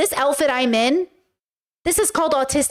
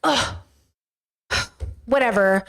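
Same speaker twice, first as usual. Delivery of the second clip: upset and dismissive; 2 semitones lower than usual. Acted. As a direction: act out a sulky brush-off. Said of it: "not regulated in their emotions".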